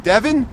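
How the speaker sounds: Devon? asked with a high rising tune; questioning retry. Retold or imitated. Retelling his correction on the live, rising and unsure. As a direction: try Devon unsure, pitch climbing up. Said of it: "And I said".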